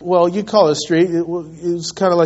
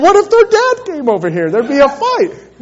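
alarmed; 9 semitones higher, 8 dB louder than usual. Retold or imitated. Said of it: "I'm thinking".

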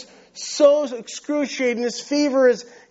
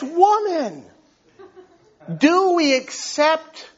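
high, slowing rhetorical challenge; retold rebuke; 6 semitones higher, slower than usual. Job rebuking his wife, measured challenge. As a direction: call her out, then slow right down.

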